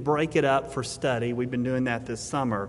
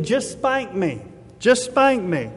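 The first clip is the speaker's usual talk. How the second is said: higher-pitched pleading, building louder on the repeat; imitated child's begging; 5 semitones higher, 4 dB louder, slower than usual. Child begging father to avoid humiliation at the store, imitated pleading. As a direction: plead like a desperate child, drawn out then stronger. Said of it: "this child begged and pleaded".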